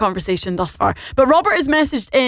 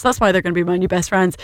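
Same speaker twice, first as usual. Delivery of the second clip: normal talking voice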